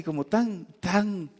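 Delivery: ordinary talk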